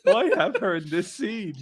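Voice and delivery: high-pitched, laughing mock bafflement; amused, exasperated retelling; laughing disbelief. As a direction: ask it incredulously through laughter. Laughing retelling of his baffled reaction.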